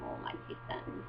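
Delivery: whispered, melting "oh, my kitten"; whispered aww. Retold or imitated. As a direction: breathe it out, overcome with cuteness.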